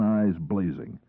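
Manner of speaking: ordinary delivery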